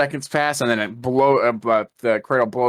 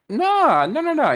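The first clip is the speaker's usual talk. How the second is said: very high, drawn-out no; retold objection in a much higher voice; mock protest; slower than usual. Retelling Cap defending Tony to Wanda, high mock protest.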